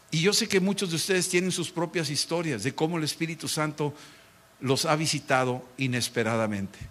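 ordinary talk

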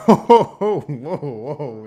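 surprised laugh, high burst; laughing "whoa, whoa" in surprise. Real, not acted. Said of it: "it's like noon right now".

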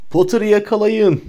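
high, drawn-out battle cry; shrieked order, stretched out high; shouted command. A narrator's reading. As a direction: cry out an order to seize him. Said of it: "diye haykırdı Bellatrix".

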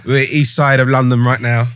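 loud laughing London geezer voice; loud matey lad banter. Pretend. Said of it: "Yeah, yeah, do that".

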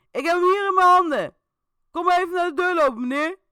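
loud, high, fast call; an officer ordering the man out; police shout. A narrator's reading. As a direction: bark it through the door. Agent shouts through door.

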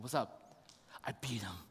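very soft, low, nearly whispered exchange; hushed retelling. Retold or imitated. Son telling dad of his win, hushed. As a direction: drop to a quiet, understated murmur. Retells son's proud quiet announcement.

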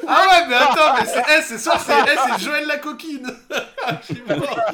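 loud laughing through words; can barely talk for laughing; laughing loudly. Real, not acted. Cracks up at friend's joke. (fou rire bruyant)

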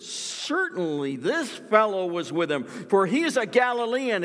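high, loud, certain accusation; insistent accuser. Acted. Bystander insisting Peter is Galilean, loud and certain. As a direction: press the charge, sure of it.

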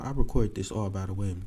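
ordinary talk

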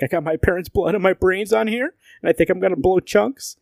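high-pitched mock complaint; high, hammed-up distressed kid; whining kid role. Acted kid complaining to Batman.

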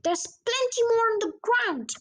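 high, eager call; excited shout. A narrator's reading. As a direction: urge them on from a distance. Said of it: "my father shouted".

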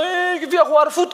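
high-pitched tearful boy, imitated; mock crying child. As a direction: act out a son whimpering to his dad. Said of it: "Estaba llorando".

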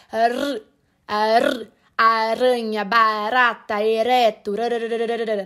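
exaggerated rolled R, ending in a "rararara" run; well rolled R. Shown as a demonstration. Teacher isolating the sound for learners, rolled R. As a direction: trill the R alone, then in "aranha, barata, ereto". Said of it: "esse R bem enrolado".

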